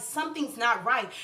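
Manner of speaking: usual speaking voice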